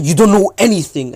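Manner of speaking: loud, high, furious outburst; angry shout. Acted. Sasuke lashing out at Naruto, angry shout. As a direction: snap back hard at him.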